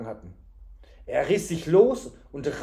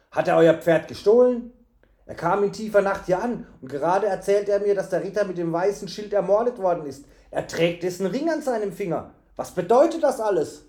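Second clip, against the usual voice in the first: louder, higher, worried questions; agitated innkeeper; 4 semitones higher, 6 dB louder than usual. A narrator's reading. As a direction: pile up the questions in alarm. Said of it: "rief der Wirt".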